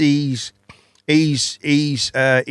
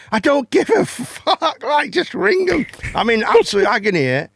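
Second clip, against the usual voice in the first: half-laughing, half-pleading to just call; laughing exasperation; 11 semitones higher than usual. Retold or imitated. Retelling shouting to call ambulance.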